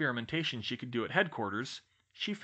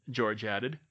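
ordinary delivery, nothing marked

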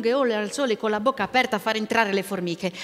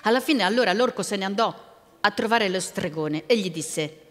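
ordinary delivery, nothing marked

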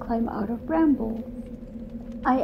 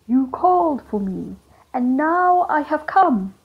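the Dark Man; 4 semitones higher, 7 dB louder than usual. Acted. Summoned Dark Man appearing before the cook, loud and solemn. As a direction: announce your arrival like a summoned spirit.

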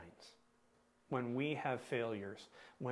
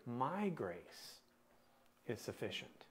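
"My" held and set apart, "is sufficient" softer; stress on MY; 7 dB quieter than usual. Shown as a demonstration. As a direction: lean on "My", then let "is sufficient" drop away quietly. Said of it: "what word you emphasize here".

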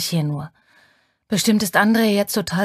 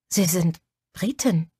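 ordinary delivery, nothing marked

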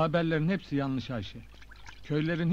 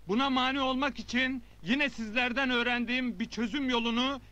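raised oratory to a crowd; public speech; 7 semitones higher than usual. Acted. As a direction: address the village square. Addresses assembled villagers. (kalabalığa hitap eden nutuk)

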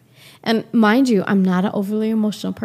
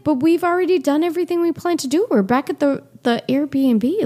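retold plea, high and quick; higher-pitched, hurried objection; pleading protest; 7 semitones higher than usual. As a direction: imitate reasoning urgently with a stubborn partner. Retold plea to ex about aunt.

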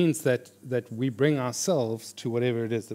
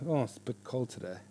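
soft, downbeat moan; mock killjoy turning down a treat; negative grumble; 8 dB quieter than usual. Negative person refusing an ice cream, grumbling. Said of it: "somebody who's really negative".

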